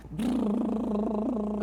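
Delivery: slow rolling lip trill brrr; toy truck lip buzz. Shown as a demonstration. Playing trucks with a boy, slow lip trill engine noise. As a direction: buzz your lips like a little truck. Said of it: "brincando com a criança".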